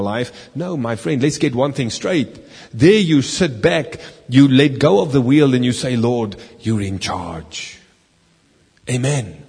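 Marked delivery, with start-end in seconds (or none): 6.0-9.4 slow, hushed, lower prayer of surrender; quiet slow giving-up prayer. Pretend. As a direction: hand it over to the Lord softly. Preacher voicing a surrendering prayer.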